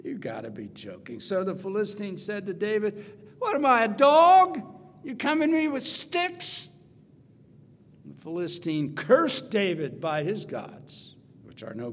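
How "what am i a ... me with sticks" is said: booming, much higher and louder mocking challenge; giant's taunt. Preacher voicing Goliath mocking David, loud and high. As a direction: bellow it down at the boy like a scornful giant.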